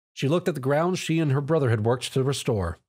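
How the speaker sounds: ordinary delivery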